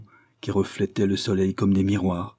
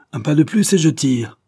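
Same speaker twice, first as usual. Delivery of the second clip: loud, high threat to fire; acted gunman's command; ordering; 7 semitones higher, 7 dB louder than usual. A narrator's reading. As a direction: halt him with a last warning. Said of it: "ordonna Kulo".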